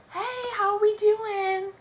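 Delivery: high, loud, drawn-out mock greeting; oblivious cheery doctor. Doctor strolls into delivery room, oblivious and cheery. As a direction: stretch out a breezy hello, way up high.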